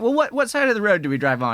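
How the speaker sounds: a hammed-up British instructor, cheery; driving instructor greets his new pupil, in a bad British accent; terrible British accent. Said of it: "I'm gonna probably do some terrible British accents now".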